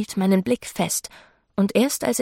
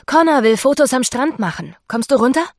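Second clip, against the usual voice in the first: loud, higher call to someone downstairs or up; 4 semitones higher, 7 dB louder than usual. A narrator's reading. Ruby calls the narrator down for beach photos, calling out. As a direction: call out brightly, ending on a question.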